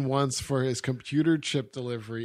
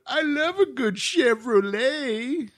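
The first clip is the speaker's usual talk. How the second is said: Belushi impression; 9 semitones higher, slower than usual. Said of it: "Jim Belushi's one line of".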